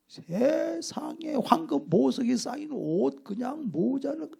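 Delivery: usual speaking voice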